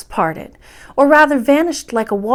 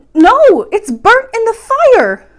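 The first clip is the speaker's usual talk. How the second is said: loud, high wail of alarm; distressed cry; 11 semitones higher, 10 dB louder, slower than usual. A narrator's reading. Princess to grandmother, loud high alarmed cry. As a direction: cry out that it's burnt. Princess sees ball burn.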